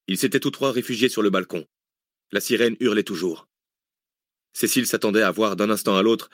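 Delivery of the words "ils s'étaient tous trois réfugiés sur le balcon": usual speaking voice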